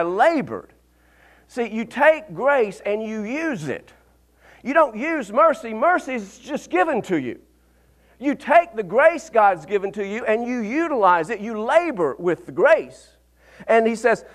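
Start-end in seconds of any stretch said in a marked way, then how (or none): none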